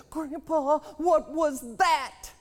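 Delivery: put-on high, loud and slow, a frightened boy's voice; boy calling to his grandpa in alarm; scared child. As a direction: ask it like a spooked little grandson. Grandson asks about a scary sound.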